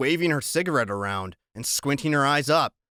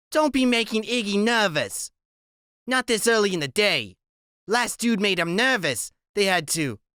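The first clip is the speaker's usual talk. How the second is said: a higher, put-on woman's voice; 7 semitones higher than usual. A narrator's reading. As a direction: voice the squinting woman, pitched up. Loretta warning the boys.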